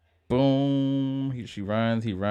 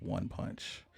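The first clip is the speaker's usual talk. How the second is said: dramatic whisper; 3 semitones lower, 15 dB quieter than usual. Acted. Dramatic line from synopsis.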